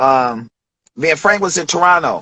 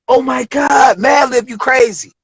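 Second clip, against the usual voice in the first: excited hype; 8 semitones higher than usual. Retold or imitated. Rapper hyping up a friend's beats, excited. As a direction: hype it up like hearing a crazy beat. Reacting to beat two.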